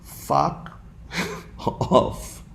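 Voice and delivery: soft, breathy with a laugh; neutral sound demo. Shown as a demonstration. Speaker letting students hear the word, soft and laughing. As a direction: let the word sound harmless. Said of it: "if you hear the word".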